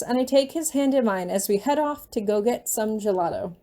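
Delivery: plain reading voice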